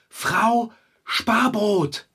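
very slow, drawn-out dramatic name; ominous name. Acted. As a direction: let the name land like a doom. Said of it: "Ich sage nur zwei Worte". (Figarino kündigt Long John die Gefahr an, gedehnt und dramatisch)

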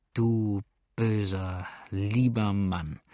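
slow, drawn-out loving reproach; fond exclamation. A narrator's reading. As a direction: linger tenderly on each word. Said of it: "rief das schöne Weib".

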